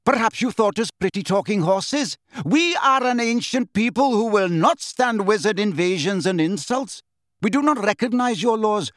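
high, forceful declaring; indignant tirade. A narrator's reading. Centaur of ancient people rejecting wizards, indignant.

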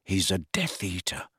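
quiet, measured realisation; hushed, deliberate statement; slowly. A narrator's reading. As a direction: say it slowly and softly. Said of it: "said Harry slowly".

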